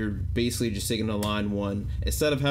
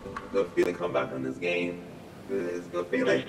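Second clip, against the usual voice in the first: voice changer effect; 4 semitones lower than usual. Shown as a demonstration. Voice changer preset while gaming.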